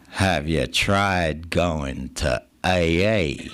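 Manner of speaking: slow, deep mock Texan drawl. Texan friend suggests A A, slow low Texan drawl. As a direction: draw it out like a Texan. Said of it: "he's got that Texan drawl".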